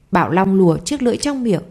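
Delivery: plain reading voice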